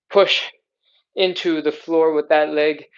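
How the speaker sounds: normal talking voice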